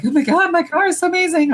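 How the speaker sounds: high, fast mock gush; hammed-up rave over a car; gushing car lover. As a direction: parody a car-obsessed coworker. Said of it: "who was like".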